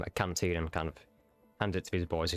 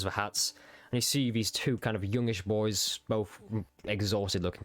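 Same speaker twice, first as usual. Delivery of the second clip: normal talking voice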